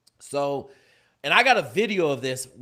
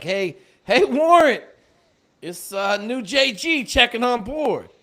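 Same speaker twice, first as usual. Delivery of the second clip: raised, high announcing call; 6 semitones higher, 7 dB louder, slower than usual. Retold or imitated. New guy announcing himself to warrant officer, calling out. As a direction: sound off loudly to a superior.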